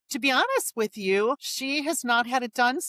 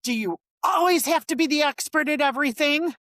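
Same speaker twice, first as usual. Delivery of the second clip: higher-pitched mock exasperated demand; exasperated, raised-pitch imitation of a telling-off; retold angry outburst; 6 semitones higher, 4 dB louder than usual. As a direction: act out a boss letting loose. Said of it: "he just lets go".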